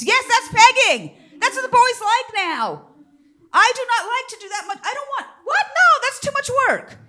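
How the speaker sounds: high, loud incredulous ranting; mock shock. Comic to audience, high loud mock outrage. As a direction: whoop it up in disbelief.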